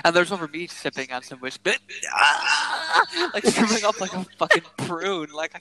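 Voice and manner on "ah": sudden high yelp of disgust; disgusted cry. Retold or imitated. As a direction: yelp as if tasting something awful. Recalling the whiskey taste.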